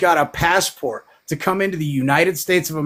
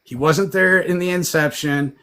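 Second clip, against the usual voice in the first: ordinary talk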